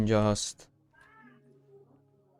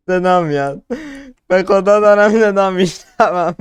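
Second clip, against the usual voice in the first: frightened louder; 4 semitones higher, 7 dB louder than usual. Real, not acted. Hears noise in horror game.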